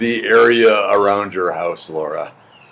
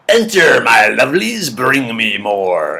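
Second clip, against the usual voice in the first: Russian witch luring in her food; hungry belly impression; slower than usual. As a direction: voice a belly greedily summoning bacon. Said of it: "My impression of my belly seeing bacon".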